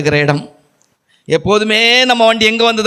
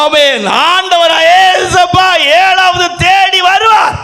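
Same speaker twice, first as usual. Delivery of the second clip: high, loud preaching shout, slow; fervent shouted sermon; preaching at full force; 13 semitones higher, 3 dB louder, slower than usual. Acted. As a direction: proclaim it, crying out.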